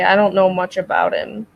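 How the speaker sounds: ordinary delivery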